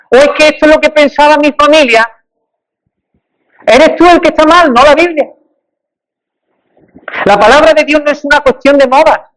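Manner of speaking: pitched high and urgent, pressing the point hard; fervent preaching. Acted.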